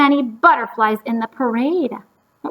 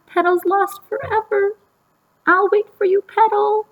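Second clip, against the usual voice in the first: put-on girl's voice; 9 semitones higher than usual. Girl's voice in story.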